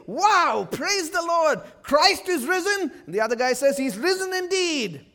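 loud, high, over-the-top praise; mock joyful exclamation. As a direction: ham up the joyful shout of the disciples. Mock disciples' joyful reaction.

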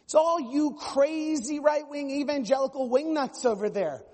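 shrill out a hammed-up accusation; mock indignant progressive. Mocking the other side.

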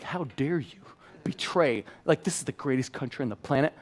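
whispered indignation, hushed; mock outraged whisper. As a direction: hiss a scandalized how dare you. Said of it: "I was like".

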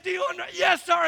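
high-pitched order cut off by a reply; frantic back-and-forth imitation. Preacher acting out drill instructor and recruit, frantic. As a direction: jump between instructor and flustered recruit.

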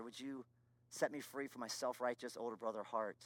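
hushed, low plea; soft, subdued prayer with a pause; retold humble prayer. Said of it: "I just, I cried, I wept".

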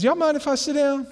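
high-pitched, forward friend; Skin. Retold or imitated. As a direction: ask in a high, cheeky voice. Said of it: "Y'all gotta know Skin".

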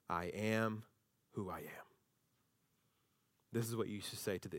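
low, soft, reverent; quiet weight on each word; solemn divine voice. Acted.

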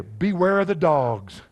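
slow, loud and emphatic, leaning on each word; stern warning. Acted. Preacher warning his church, slow and loud. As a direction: read it out as a solemn alarm.

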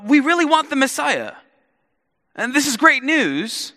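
high, loud, thrilled villagers; eager crowd. Retold or imitated. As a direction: voice the crowd's excitement as they hear the news. Crowd hoping for the Messiah.

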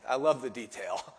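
normal talking voice

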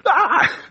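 short, high yelp of fright; mock startled scream. Preacher reenacting the family's scare, mock startled scream. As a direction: yelp as if spooked, playfully. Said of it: "Scared us though".

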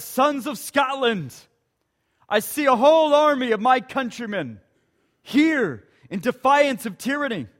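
rally the troops; rousing speech to the soldiers; William Wallace rallying speech. Acted. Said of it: "William Wallace comes along and says".

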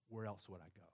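low, hushed, resigned question; humble confession. Acted. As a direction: confess it softly, as if to yourself. Believer's humble confession, acted softly.

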